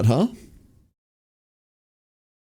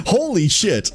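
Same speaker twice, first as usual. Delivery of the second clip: laughing disbelief; 11 semitones higher, slower than usual. Real, not acted. Laughing at the rage story.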